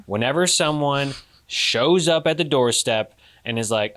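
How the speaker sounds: usual speaking voice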